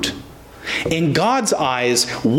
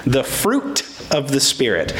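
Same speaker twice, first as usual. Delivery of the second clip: ordinary delivery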